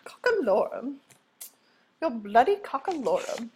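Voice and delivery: toff voice, teasing an insult; jokey British twit voice; mock British accent. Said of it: "It just sounds so British".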